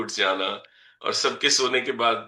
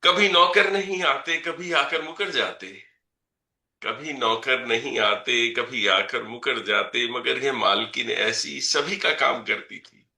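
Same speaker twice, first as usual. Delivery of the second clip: measured, rhythmic reading of verse; poem recitation; 3 semitones higher, 4 dB louder than usual. Acted. Poet reciting a poem about his mother to the audience, measured recitation. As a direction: recite each line and let it land.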